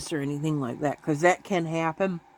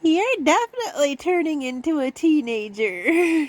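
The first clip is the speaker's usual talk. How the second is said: teasing kitten; 9 semitones higher than usual. Retold or imitated. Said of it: "I'm like".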